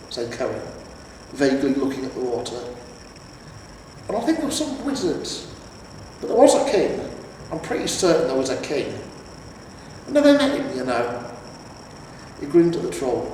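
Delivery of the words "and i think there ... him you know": put-on much higher rambling old man; lightly voiced doddery warrior; vague old hero. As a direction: drift off remembering.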